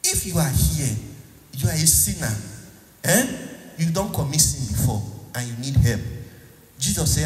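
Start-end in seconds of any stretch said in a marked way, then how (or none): none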